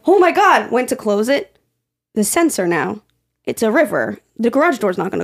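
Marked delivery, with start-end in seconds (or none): 0.1-0.7 high, loud oh my God; panicked gasp. Retold or imitated. Retelling the moment the water rushed in, a high panicked oh my God. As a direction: blurt it up high in alarm. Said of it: "I was like".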